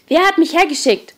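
high, loud angry demand; angry hissed outburst. A narrator's reading. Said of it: "fauchte Thomas ihn an". (wütend angefaucht)

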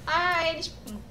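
ordinary delivery

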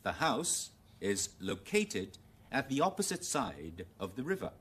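slow, careful British model reading; British English. Shown as a demonstration. Teacher reading example sentence, British English. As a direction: read it in British English, loCATEd stressed late. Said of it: "用英語先".

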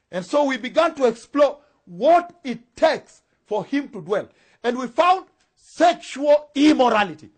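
booming, measured sermon cry; full-force preaching. Real, not acted. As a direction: proclaim each point slowly and forcefully.

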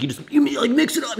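high-pitched imitation of an excited friend; Joe. Imitating the friend pitching his frother, high mocking voice. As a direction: mimic a friend gushing in a high voice. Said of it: "that's how he talks".

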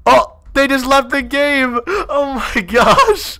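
high, slow laughing disbelief. Real, not acted. Laughing disbelief after they left.

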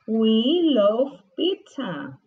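slow, clear classroom model of I like strawberry; slow model sentence. Shown as a demonstration. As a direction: say it slowly for the kids to copy. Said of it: "Nos encanta la pizza". (despacio y claro, como modelo)

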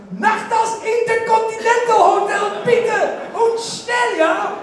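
high excited shout in broken German. Retold or imitated. Shouting to the taxi driver in German, high and excited. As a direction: yell it at the taxi driver, thrilled. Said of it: "En ik hoor mezelf schreeuwen".

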